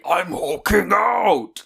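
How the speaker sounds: slow, gravelly old-man impression; Harrison Ford voice. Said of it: "Harrison Ford to say".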